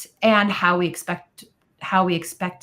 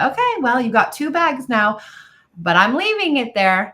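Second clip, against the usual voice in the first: breezy mom; 6 semitones higher, 5 dB louder than usual. Retold or imitated. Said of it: "I would say".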